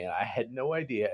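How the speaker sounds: ordinary delivery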